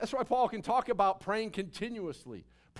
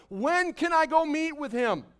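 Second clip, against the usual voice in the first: louder and higher, yearning; passionate cry; 6 semitones higher, 5 dB louder than usual. Real, not acted. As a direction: cry out with longing. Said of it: "hungry and thirsty, starving for God".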